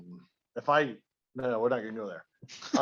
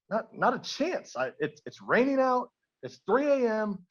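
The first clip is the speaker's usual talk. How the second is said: acted protest, pitched higher; mild indignant refusal; 6 semitones higher than usual. Husband turns away a drunk stranger at night, mildly indignant. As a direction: play a put-out husband lightly.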